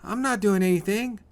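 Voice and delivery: very high, loud whine; whining. A narrator's reading. Tommy pleads with bullies against the wall, whining. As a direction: whine like a cornered kid. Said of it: "whines Tommy".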